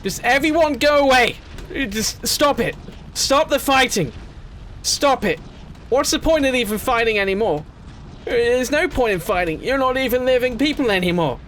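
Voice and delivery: a pleading, frustrated shout; shouting at them to stop. Acted. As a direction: scold the battling crowd at full voice. Yelling at fighting army units.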